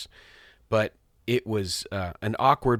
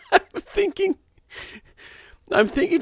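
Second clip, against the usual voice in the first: breaks into a high laugh mid-sentence; 5 dB louder than usual. Real, not acted. Dad laughing as he retells his daughter's remark. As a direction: laughs through the words at the memory.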